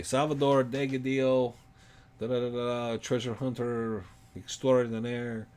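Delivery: grand self-introduction. Pretend. Host mimicking a guest's grand self-introduction with a showman flourish. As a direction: roll it out like a showman. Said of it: "You're supposed to say".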